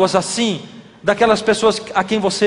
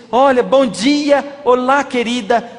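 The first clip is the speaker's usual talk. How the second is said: sweet greeting; 5 semitones higher, slower than usual. Retold or imitated. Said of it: "eu disse".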